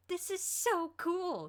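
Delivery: overjoyed, stretch every word; jubilant. A narrator's reading. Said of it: "jumping up from the bench in jubilation".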